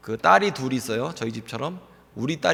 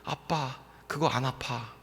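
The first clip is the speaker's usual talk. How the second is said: softer, confiding aside; into his ear; 8 dB quieter, slower than usual. Retold or imitated. Daughter murmuring into dad's ear, softer and secretive. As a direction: slip it quietly into dad's ear. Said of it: "귀에다 대고 이렇게 얘기를 하는 거예요".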